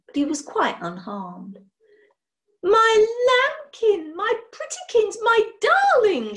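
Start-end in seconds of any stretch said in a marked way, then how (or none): 2.6-6.3 much higher and louder, slow doting shriek. A narrator's reading. Mother shrieks with joy over her returned baby. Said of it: "shrieked Missus Boothroyd".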